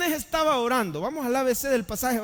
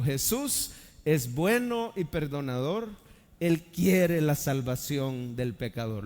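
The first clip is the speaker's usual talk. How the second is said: slow recitation; 6 semitones lower, slower than usual. Shown as a demonstration. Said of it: "la repita conmigo".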